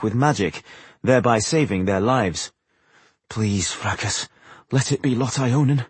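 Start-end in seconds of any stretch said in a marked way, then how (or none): 3.3-5.9 quiet prayer. A narrator's reading. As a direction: pray under your breath. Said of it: "he prayed".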